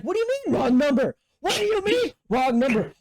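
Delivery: high, indignant retort; retold exasperated challenge; pissed-off disbelief. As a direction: throw it back high and outraged. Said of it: "it was pissing me off".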